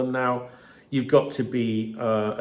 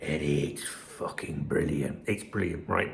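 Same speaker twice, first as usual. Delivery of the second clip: excited whisper; 10 semitones lower, 8 dB quieter than usual. Acted. Gleeful aside about the article.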